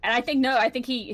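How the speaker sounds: ordinary talk, nothing marked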